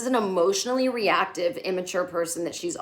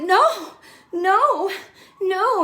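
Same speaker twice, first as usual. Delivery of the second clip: high, loud, shrill-pitched no no no; mocking impression of Carrie in a panic; Carrie's frantic no; 12 semitones higher, 7 dB louder, much slower than usual. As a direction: mimic Carrie's panicky denial. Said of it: "in her Carrie way".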